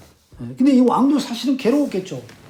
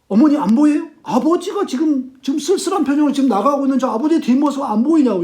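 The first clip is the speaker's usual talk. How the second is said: agitated insistence; 6 semitones higher than usual. Retold or imitated. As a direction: urge mother to see what you see. Hamlet pleading that ghost is visible. (높고 크게 다급히 따져 묻는 말투)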